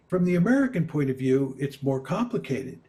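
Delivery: ordinary delivery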